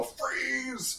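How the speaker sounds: high, soft drawn-out cry; mock superhero command; acted power cast. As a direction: stretch it out high, held back in volume. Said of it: "use your determination to go".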